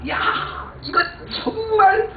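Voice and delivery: overjoyed cry of thanks; exaggerated thanks. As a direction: swap the curse for a hammed-up thank-you. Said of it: "욕을 한 게 아니라".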